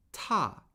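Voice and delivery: "ta" falling sharply from high; fourth tone. Shown as a demonstration. Teacher modelling tones for repeating, "ta" high then falling. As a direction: start "ta" high and drop it steadily. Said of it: "the fourth tone steadily falls".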